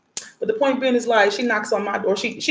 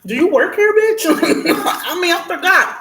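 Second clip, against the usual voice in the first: mocking yell; 9 semitones higher, 4 dB louder, slower than usual. Mocking outburst at the lady.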